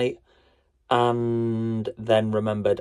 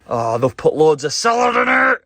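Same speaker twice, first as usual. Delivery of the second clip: dismayed outburst; 5 semitones higher, 6 dB louder than usual. Real, not acted. Dismay at salad in spud.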